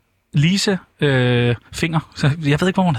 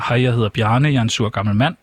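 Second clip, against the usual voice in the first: ordinary delivery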